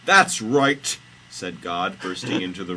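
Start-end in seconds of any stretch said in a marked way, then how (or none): 0.1-1.0 high, loud declaration; hammed-up triumphant deity; God's entrance. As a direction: burst in at full voice. God bursts into room.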